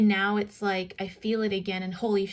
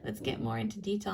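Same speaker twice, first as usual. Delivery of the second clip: ordinary delivery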